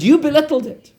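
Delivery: high, loud stern rebuke; the Rebbe scolds him. Retold or imitated. Rebbe rebukes the man who mocked the deal, loud and high.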